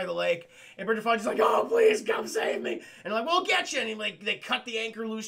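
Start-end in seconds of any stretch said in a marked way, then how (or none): 1.4-2.8 high, loud cry of please, then a breathless plea; hammed-up damsel begging rescue; mock plea for help. Dragged across the lake, she begs the others in a hammed-up cry. 3.2-3.8 calling out. Retold or imitated. Rescuers calling to Fonda.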